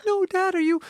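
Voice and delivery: put-on very high, drawn-out child voice; worried kids. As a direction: imitate alarmed children fretting over Dad. Voicing his worried kids.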